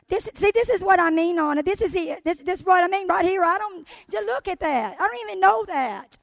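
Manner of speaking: getting worked up about the point; excited. Real, not acted.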